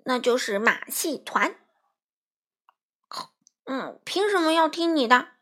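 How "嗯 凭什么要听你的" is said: higher-pitched, unconvinced protest; indignant muttered challenge, pitched up; defiant grumble. A narrator's reading. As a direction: grumble back, refusing to take orders. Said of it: "珠宝不服气地嘟哝着".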